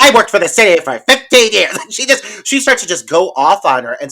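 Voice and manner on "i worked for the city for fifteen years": mock tirade. Miss Allen going off on Sully.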